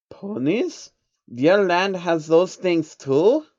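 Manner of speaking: a banker caught off guard; surprised. Acted.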